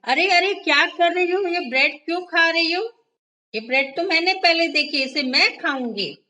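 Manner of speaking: high, loud squeaky cry of protest; shouting. A narrator's reading. Mini shouting at Tiny over the bread. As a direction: yell it out like a greedy little mouse. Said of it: "तभी मिन्नी चिल्लाई".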